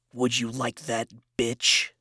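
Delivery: plain reading voice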